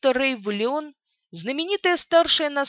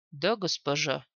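low servile necromancer; 6 semitones lower, 5 dB quieter than usual. Acted. Necromancer obeys.

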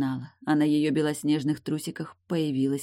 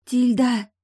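loud, high call to rouse someone; raised, higher calling voice; 9 semitones higher, 7 dB louder than usual. A narrator's reading. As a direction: call her name urgently from a distance. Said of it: "раздавался голос Эйлин откуда-то издалека".

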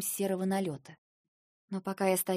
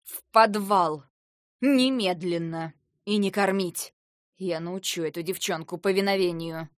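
furious shout; 6 dB louder than usual. A narrator's reading. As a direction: slam down each order in rage. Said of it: "глаза Аярны гневно сверкнули".

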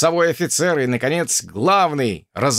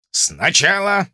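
raised, slow officer's order; commanding instructor; 6 semitones higher, 3 dB louder, slower than usual. Acted. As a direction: say it like an officer teaching drill. Drozd's instruction, second take.

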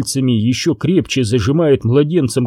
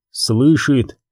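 ordinary delivery

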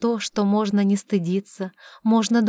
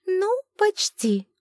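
very high, slow doubting sprite; tiny crystal voice, unsure; тоненький хрустальный голосок; 11 semitones higher, slower than usual. Acted. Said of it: "усомнился первый". (тоненько и с сомнением)

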